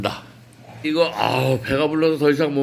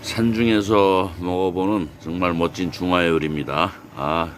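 calm, lower narrator voice; documentary narration; 7 semitones lower, 3 dB quieter than usual. Acted. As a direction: narrate the scenery like a travel show. Morning scenery narration.

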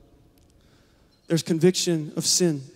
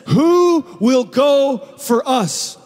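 slow, higher, booming call from the throne; God calling from His throne, slow and booming; 4 semitones higher, slower than usual. Acted. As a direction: call out slowly as God seeking a messenger. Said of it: "It's a booming voice".

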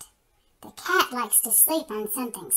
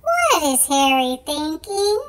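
usual speaking voice

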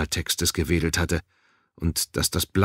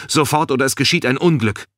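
high, loud warning; urgent call; 8 semitones higher, 5 dB louder than usual. A narrator's reading. Balin warns the guard, urgent raised voice. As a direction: call out as if disaster looms. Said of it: "rief Balin".

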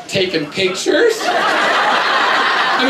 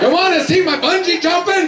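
put-on pitched-up eager kid voice; eager son; 7 semitones higher than usual. Son pestering home teachers with his video, eager. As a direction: push the video on every guest.

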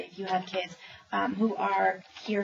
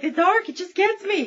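much higher, louder fretful dog; whiny scared dog; 10 semitones higher, 6 dB louder than usual. Acted. Dogs admit fear of dark.

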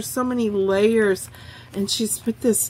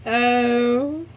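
ordinary talk, nothing marked

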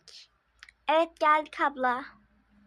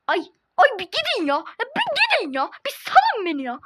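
exasperated whine; 5 semitones higher, 4 dB louder than usual. Acted. Annoyed at pushy staff.

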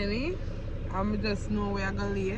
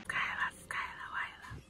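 soft whispered calling of a name; whispering a name; 9 dB quieter than usual. Real, not acted.

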